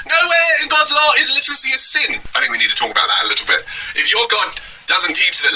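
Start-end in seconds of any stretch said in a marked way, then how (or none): 0.1-2.2 high-pitched mock preacher; Preacher Adam. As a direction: lay down scripture in a squeaky caricature. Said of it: "He says this, Preacher Adam".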